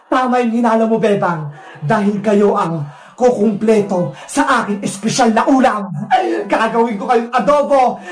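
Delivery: low villain voice with evil cackles; gloating wicked vendor. Acted. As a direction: gloat darkly and cackle at your captives. Villain threatens to cook children. (mababang boses ng masamang tindera, humahalakhak)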